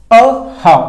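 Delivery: "a how" as two separate beats, weight on how; stress on HOW. Shown as a demonstration. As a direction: isolate and stress "how" after a short pause. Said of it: "how में stress देकर बोला जा रहा है".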